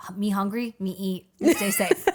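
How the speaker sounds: loud dumb caveman grunting. Retold or imitated. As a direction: play a simple caveman. Caveman brain voice.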